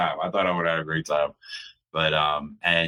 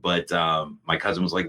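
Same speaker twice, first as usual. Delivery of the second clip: ordinary talk, nothing marked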